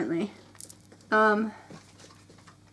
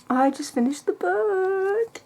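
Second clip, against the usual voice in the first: much higher and slow, savoring each word; excited, drawn-out; 9 semitones higher, slower than usual. Real, not acted. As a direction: stretch it out, giddy and amazed. Repeats finishing news, drawn out.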